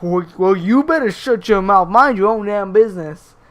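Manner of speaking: mock furious telling-off, punched out loudly; retold angry escalation. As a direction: imitate an irate customer, loud and forceful. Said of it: "he starts escalating it even more".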